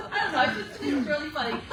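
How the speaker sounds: real laughter, still high-pitched; cracking up while talking; laughing. Real, not acted. Laughing at own imitation.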